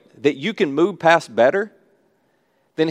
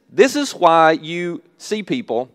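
ordinary talk

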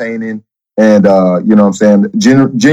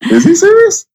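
much higher, disbelieving squeak; incredulous. Retold or imitated. Guest recalling watching a housemate's outburst, high incredulous squeak. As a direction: ask it like you can't believe your ears. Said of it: "I was like".

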